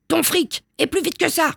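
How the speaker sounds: high, pushy threat; bullying demand. A narrator's reading. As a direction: demand it like a playground thug. Bully shaking victim for money.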